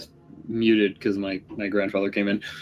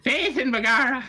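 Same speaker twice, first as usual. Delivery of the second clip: very high mock Irish exclamation; leprechaun-style Irish accent; 12 semitones higher than usual. Player trying out a dwarven thief, mock Irish leprechaun accent. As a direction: echo it in a squeaky Irish leprechaun voice. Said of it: "Make you sound like a leprechaun".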